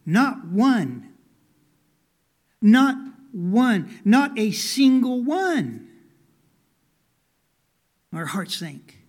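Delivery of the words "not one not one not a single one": slow, emphatic declaration, rising in pitch and force; acted insistence, stretched out on the last phrase; building emphasis. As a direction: hammer each repeat a bit harder. Boy marching in, proudly repeating it.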